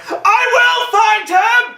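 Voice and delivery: slow, very high, loud howl breaking into laughter; mock howl. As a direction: howl it at the sky like a ham villain. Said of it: "Zod howls up at the sky".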